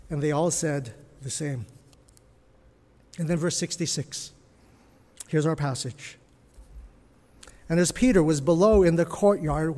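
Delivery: measured, dramatic Bible reading to the congregation; a preacher reading the denial of Peter aloud; scripture reading. Acted. Reads Mark passage to church.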